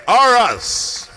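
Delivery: slow, loud, stretched team name; hype shout. Real, not acted. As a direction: punch it out like an arena announcer. Announcer hypes team name.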